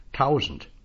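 plain reading voice

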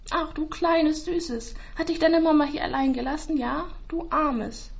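put-on high, slow cooing to a baby; tender baby talk. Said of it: "drückt es liebevoll an sich". (zärtliches, hohes Babygeplapper)

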